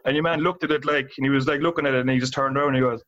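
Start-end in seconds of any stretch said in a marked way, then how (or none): none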